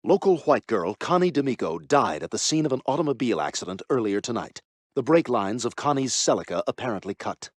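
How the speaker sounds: sad newscaster voice. Acted. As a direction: read the death report with mock sorrow.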